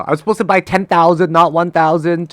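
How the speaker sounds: mad. Real, not acted. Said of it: "I'm mad now".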